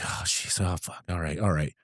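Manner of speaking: soft, low muttering to himself; retold private dread, dropped under the breath; worried self-talk. Newcomer talking himself into staying, worried mutter.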